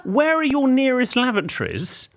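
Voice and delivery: high, slow mocking echo through a laugh; mocking repeat. Retelling the shop exchange, mockingly slow and high. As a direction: sneer the question back, laughing. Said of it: "What a pillock".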